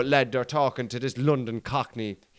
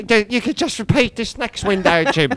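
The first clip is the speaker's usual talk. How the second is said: higher, slow matey voice; imitating Harry Redknapp; 8 semitones higher, 4 dB louder than usual. Manager joking with the reporter, higher and slow. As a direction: tease the reporter like an old manager. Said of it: "I love Cockney storytellers".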